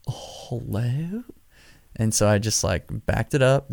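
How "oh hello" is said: much softer, slow puzzled hello; baffled hello. Retold or imitated. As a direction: ask into a dead line, quietly. After he hung up.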